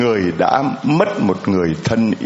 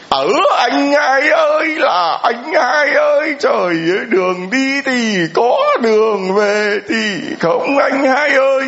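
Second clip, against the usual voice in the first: hammed-up funeral wail. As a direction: wail for big brother like a paid mourner. Imitating hired mourner wailing.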